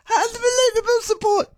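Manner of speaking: high, strained lost-voice croak; imitating Harry Kane. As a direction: push a wrecked voice up high. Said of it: "trying to imitate Harry Kane".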